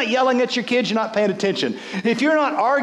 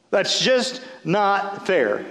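slow, drawn-out repeat of the complaint; much slower than usual. Retold or imitated. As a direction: stretch every word out. Mocking Martha's complaint.

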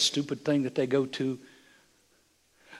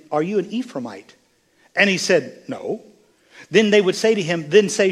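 loud, raised-pitch scripture proclamation; forceful preaching; 4 semitones higher, 7 dB louder than usual. Acted. Preacher telling of Gileadites and Ephraimites, forceful preaching. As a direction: declaim it to the whole congregation.

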